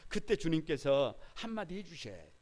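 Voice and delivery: ordinary delivery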